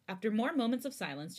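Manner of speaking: ordinary delivery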